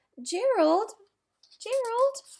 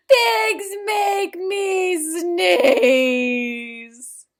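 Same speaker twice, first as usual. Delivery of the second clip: very slow, louder drawn-out wail; dramatic cry; 5 semitones higher, 9 dB louder, much slower than usual. Acted. Elephant realizes pigs make him sneeze, drawn-out wail. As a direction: stretch it out in horror.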